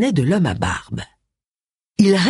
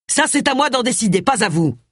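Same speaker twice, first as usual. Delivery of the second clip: curt put-down starting high, then dropping; 6 semitones higher than usual. A narrator's reading. Inspector asserting authority over Agatha, curt. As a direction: cut the other person off curtly. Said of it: "fit Wilkes d'un ton sec".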